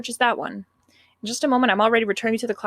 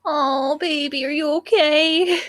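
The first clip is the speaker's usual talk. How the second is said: dramatic concern; 5 semitones higher, much slower than usual. Acted.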